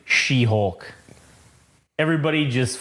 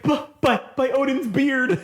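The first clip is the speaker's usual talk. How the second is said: high, stammered mock oath; falling Thor; 10 semitones higher than usual. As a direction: stammer it through a laugh. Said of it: "he's like".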